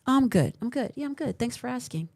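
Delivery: mock cheerful deflection hiding hurt; fake cheerful reply. Quoting a grieving person who hides behind cheer, mock breezy. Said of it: "And you say".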